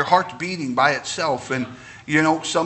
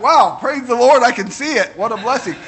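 amazed delight; 6 semitones higher, 4 dB louder than usual. Retold or imitated. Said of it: "I was like".

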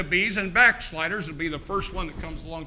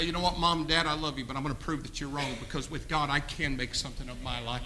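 soft, low, determined; quiet resolve; 6 semitones lower, 12 dB quieter than usual. Retold or imitated. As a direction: say it softly but firmly. Child's resolve to parents.

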